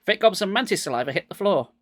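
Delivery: plain reading voice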